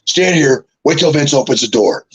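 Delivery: John Laurinaitis. Retold or imitated. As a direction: give instructions in a light impression. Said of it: "He goes".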